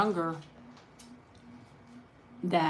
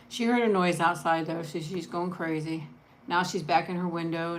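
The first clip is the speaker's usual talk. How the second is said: ordinary delivery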